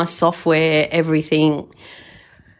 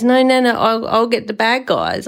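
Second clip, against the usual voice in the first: higher-pitched mock little boy; imitated six-year-old; 6 semitones higher than usual. Grandson promising Nana to get bad guys, imitated child's voice. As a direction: imitate a small grandson insisting. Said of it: "he's six, right?".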